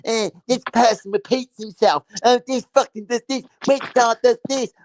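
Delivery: high mocking whiny voice; mocking imitation. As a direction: mimic him in a high, jeering voice.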